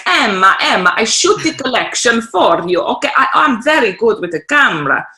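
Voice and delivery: put-on a self-confessed terrible Italian accent for a flamboyant photographer. Flamboyant Italian photographer offering to shoot her collection, hammed-up Italian accent. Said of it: "terrible accent".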